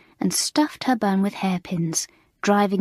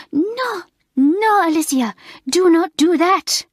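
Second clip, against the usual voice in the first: much higher, louder horrified squeal; squealing in horror; 10 semitones higher, 7 dB louder than usual. A narrator's reading. French mistress stopping Alicia reaching up the chimney, horrified squeal. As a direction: beg her to stop, near panic. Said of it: "almost squealed Mamselle in horror".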